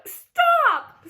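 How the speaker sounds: piercing high, loud scream. A narrator's reading. Terrified pig screaming at the old sheep. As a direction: cut him off with a shriek. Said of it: "screamed Wilbur".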